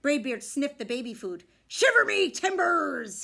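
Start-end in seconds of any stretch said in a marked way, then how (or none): 1.7-3.2 loud, slow pirate bellow; pirate yell. A narrator's reading. Pirate captain yelling at the kids over baby food. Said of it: "he yelled".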